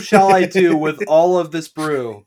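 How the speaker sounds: loud, high theatrical lament; hammy stage actor. Pretend. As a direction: ham it up like Shakespeare. Shakespearean mock lament.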